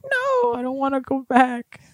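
squeaky high pleading; high whiny mermaid plea; high Ariel voice. Retold or imitated. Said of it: "She's like".

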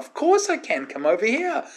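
high-pitched, ready yes; retold emphatic welcome to someone upset; eager reassurance. Eagerly answering the tearful plea.

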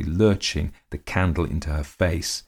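plain reading voice

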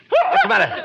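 sudden shout, pitch jumping up; startled shout. Acted. Bud jumping at Costello's sneeze, startled shout. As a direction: jump out of your skin at the noise.

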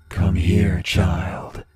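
low, whispered inhuman rasp; breathy creature beckoning; eerie voice. Acted. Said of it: "the most eerie voice came from behind the tree".

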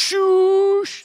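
loud, high shushing cry; wrestler's catchphrase yelled, high and loud; Chad Gable shush. Retold or imitated. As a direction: shush like a wrestler hushing the crowd. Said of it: "when he goes".

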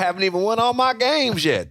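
a hint of a Southern twang. Cohost quotes the coach with a mild Southern twang. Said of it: "this guy really does have a nice little twang".

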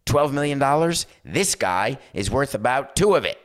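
coach to booster. Retold or imitated. Coach asking boosters to cut a deal, play-acted.

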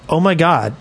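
normal talking voice

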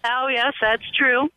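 usual speaking voice

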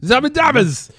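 high, far silly babble; silly gibberish. Pretend. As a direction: mangle the word for fun. Mangling the name.

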